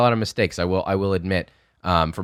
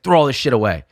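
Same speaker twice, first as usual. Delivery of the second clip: higher, louder angry order; retold yelling; 6 semitones higher, 6 dB louder than usual. Chef rejecting cook's food, imitated yelling. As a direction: give a mock-yelled command, falling at the end. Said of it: "and he starts yelling".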